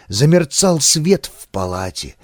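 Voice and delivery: usual speaking voice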